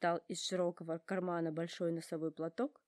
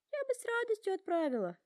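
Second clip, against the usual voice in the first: much higher giraffe voice; a high, regretful giraffe; 11 semitones higher, 3 dB louder than usual. A narrator's reading. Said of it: "говорит Жирафа".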